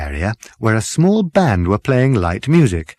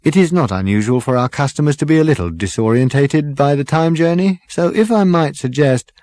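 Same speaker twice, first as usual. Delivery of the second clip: lightly raised, courteous waiter voice; smooth and deferential, serving sir; polite waiter; 5 semitones higher than usual. Acted. Waiter character voice in reading.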